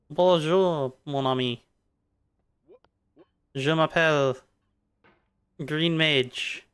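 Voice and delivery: slow, hammed-up French accent; playful French accent. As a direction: put on a drawn-out mock French voice. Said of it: "tried to go French there".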